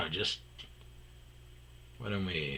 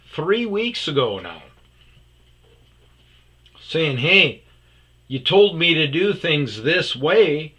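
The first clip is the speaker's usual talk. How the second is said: loud, high-pitched ranting; heated rant; 8 semitones higher, 13 dB louder than usual. Retold or imitated. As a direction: vent it all in a rising fury. Retold rant at coworker.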